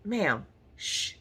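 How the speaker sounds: acted quiet shush; hushing. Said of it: "you is loud".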